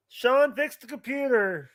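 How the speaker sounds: ordinary delivery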